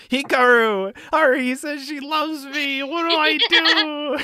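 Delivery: high, loud mock wail, drawn out at the end; hammed-up freakout calling for help; panicked scream. Tamaki screaming to Hikaru after her confession, panicked. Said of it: "And then he screams".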